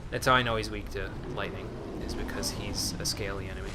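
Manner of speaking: normal talking voice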